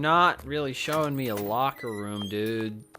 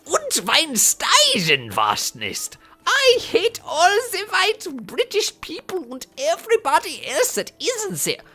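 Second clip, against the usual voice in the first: over-the-top mock German accent, pitched up; 6 dB louder than usual. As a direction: parody a cartoon German villain in a German accent. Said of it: "a stereotypical German accent".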